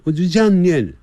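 ordinary delivery

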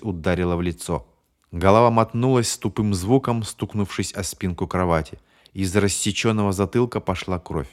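plain reading voice

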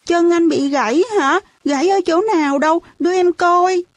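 put-on high childlike voice, concerned; anxious child's questions in a high voice; worried little kid. A narrator's reading. Worried younger child asks about leg.

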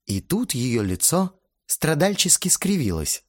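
normal talking voice

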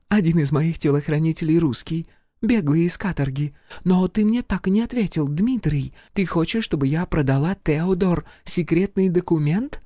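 high-pitched French lady voice; femme fatale. Acted. Charlotte's voice again.

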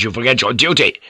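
fast, angry accusation; quick-fire lordly scolding; rapid rebuke. Acted. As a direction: rattle off the accusation sternly. Same rebuke, first rapid take.

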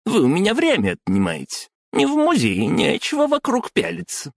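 very high squeaky scolding woman. Acted. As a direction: squeal it like a rubbery toy. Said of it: "своим писклявым голосом".

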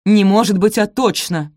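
loud, snapped correction; angry blurt. A narrator's reading. Stepmother cuts off Sophie's guess, blurting angrily. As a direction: blurt it out, cutting in loudly. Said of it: "выпалила Араминта".

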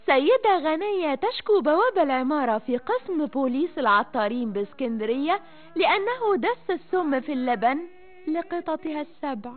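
ordinary delivery

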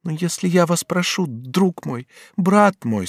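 high, imploring heroine voice; pleading. A narrator's reading. Batilda begs her friend Bonifas, pleading. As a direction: implore him, rising on each appeal. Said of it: "умоляющим голосом".

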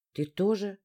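plain reading voice

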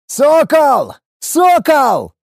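high, loud call across a battlefield; roaring with all one's might. A narrator's reading. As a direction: bellow the name to get a warrior's attention. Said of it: "рявкнул я, что было сил". (рявкая что было сил)

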